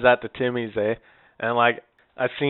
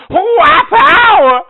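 drawn-out, high full-volume holler; very loud, stretched-out mock holler; retold yelling; 14 dB louder, much slower than usual. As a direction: imitate a man bellowing down the street. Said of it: "he's yelling it".

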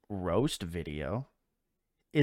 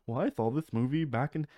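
higher mock reviewer voice; lightly voiced nostalgic film critic; Nostalgia Critic impression; 5 semitones higher, faster than usual. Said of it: "that's Nostalgia Critic".

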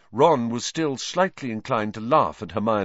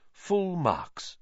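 lightly voiced Lupin; 9 semitones higher than usual. A narrator's reading.

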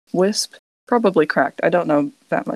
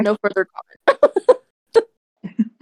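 breaking into a laugh on the word; 4 semitones higher, much slower than usual. Real, not acted. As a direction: laugh it out, squeaky and high. Laughs through "no further comment.

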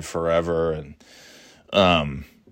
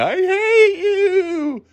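very high, drawn-out mock wail; hysterical crying; much slower than usual. Daughter left behind by her mother, mock hysterical crying. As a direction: wail it slowly in imitated hysterics. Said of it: "hysterically crying".

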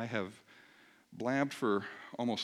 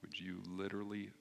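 soft, low-key prayer with long pauses; 4 semitones lower, 7 dB quieter than usual. Real, not acted. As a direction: lead them gently in prayer, pausing between phrases. Leads the congregation in prayer.

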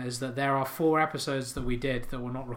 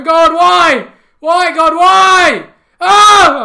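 high, very loud anguished cry to the heavens; screaming; 22 dB louder, much slower than usual. Acted. Cornflake eater screaming at God in despair. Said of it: "He's screaming".

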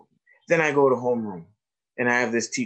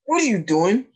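high-pitched, rising question, retold; playful challenge; 7 semitones higher than usual. Doctor nudging a kid he knows, playful challenge.

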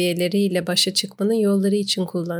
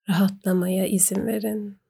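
whispering. Acted. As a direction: breathe the words out in a whisper. Whispered cue within the meditation.

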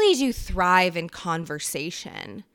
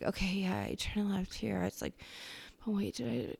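muttering to yourself; 10 dB quieter than usual. Retold or imitated. Vlogger imitating herself giving directions, under her breath. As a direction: talk yourself through it under your breath. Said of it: "trying to explain something to yourself".

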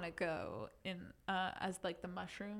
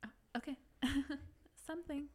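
whispering; 4 semitones higher, faster than usual. Pretend. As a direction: whisper it back. Whispered reply.

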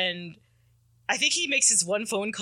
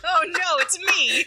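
high, louder dismay breaking into laughter; laughing mock horror; 10 semitones higher, 5 dB louder than usual. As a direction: gasp out the discovery through giggles. Mock realization while laughing.

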